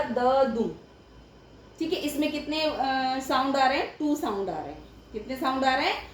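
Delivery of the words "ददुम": ददुम as two separate sounds, द and दुम; syllable by syllable. Shown as a demonstration. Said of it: "syllables जैसे मैंने ये बोला". (ददुम को दो हिस्सों में बोलें)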